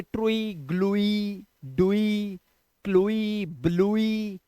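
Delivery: ट्रुई, ग्लुई, डुई, क्लुई, ब्लुई each ending in oo-ee; misreading of ue. Shown as a demonstration. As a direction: read each wrongly with a separate ee, ब्लुई as bloo-ee. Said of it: "अब इसे ट्रुई नहीं पढ़ेंगे".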